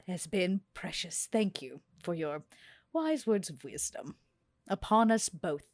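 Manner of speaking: usual speaking voice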